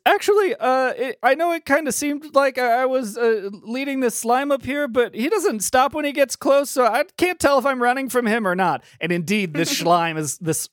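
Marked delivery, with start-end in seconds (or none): 0.1-8.8 high-pitched, faltering explanation; squeaky little fairy voice; tiny fairy. Acted. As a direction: confess sheepishly in a tiny high voice. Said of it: "he looks at you and he says".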